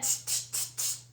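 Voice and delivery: whispered tongue clicks; tsk tsk. Acted. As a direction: tut at him like a mocking monkey. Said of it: "went like this and said".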